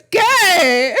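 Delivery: high, loud, squealing through laughter; laughing mock whine. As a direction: whine it out while cracking up. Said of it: "you're like".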